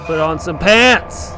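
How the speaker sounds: loud yell rising on the last word; exasperated yell. Real, not acted. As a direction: shout it at a game character. Yelling at game character.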